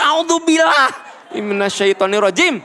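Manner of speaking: exaggerated impatient recitation; mock irritated ta'awuz. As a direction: grumble the prayer like a fed-up mother. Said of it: "Ibu udah kese diatur".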